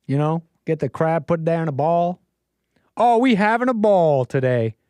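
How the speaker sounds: put-on playful caricature of a Southern crab-boil accent; mock Southern accent. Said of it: "this is really not accurate".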